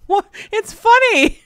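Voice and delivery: high, loud laughing brush-off. Retold or imitated. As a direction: laugh off a kid's upset. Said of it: "I started laughing".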